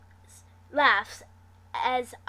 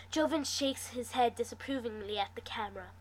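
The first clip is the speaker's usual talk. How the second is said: usual speaking voice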